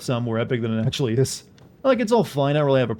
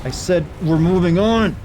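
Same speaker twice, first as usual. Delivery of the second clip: loud, slow, firm repeat; insistent shout; 5 semitones higher, 5 dB louder, slower than usual. Real, not acted. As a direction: put your foot down. Repeats the refusal louder.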